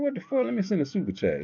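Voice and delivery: squeaky high "what the"; shocked falsetto at the failed link; mock disbelief. Blocked viewer reacting, high mock surprise. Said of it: "I'm like".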